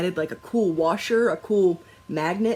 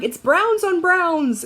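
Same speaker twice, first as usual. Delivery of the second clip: exasperated complaint; 11 semitones higher, 6 dB louder, slower than usual. Retold or imitated. Complaining about hard-to-see fabric.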